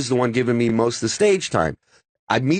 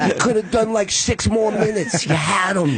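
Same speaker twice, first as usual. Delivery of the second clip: higher-pitched, delighted mock praise; imitating Dice; 6 semitones higher than usual. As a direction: egg the opener on after a disaster. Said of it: "he goes".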